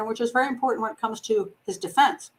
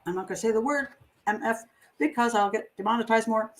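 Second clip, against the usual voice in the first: ordinary talk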